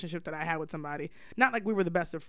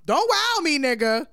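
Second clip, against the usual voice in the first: sharp, much higher and louder, WOW hit hard; angry comeback; 6 semitones higher, 6 dB louder, slower than usual. Retold or imitated. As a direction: snap it back at him, stung. Checks him for saying wow.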